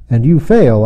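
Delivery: usual speaking voice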